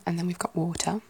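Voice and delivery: ordinary talk, nothing marked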